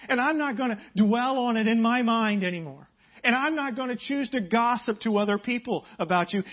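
loud, high impassioned preaching; full-force preaching. Acted. Pastor urging the congregation to real forgiveness, loud and fervent. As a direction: preach it hard, climbing in fervor.

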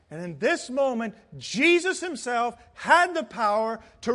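impassioned pulpit delivery; preach. Real, not acted. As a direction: preach it with rising fervour, leaning on key words. Preaching at full force.

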